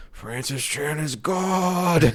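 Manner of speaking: a friend mock-worships the preacher in a slow, solemn intonation; reverential priest. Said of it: "sort of reverential, like, Mesoamerican, like, priest".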